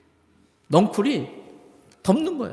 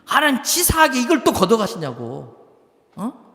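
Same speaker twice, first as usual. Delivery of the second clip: high, loud griping at God; acted tantrum; 5 semitones higher, 4 dB louder than usual. Preacher acts Jonah complaining to God over the lost plant, petulant anger. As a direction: throw an indignant mock tantrum. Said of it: "성질을 내는 거예요".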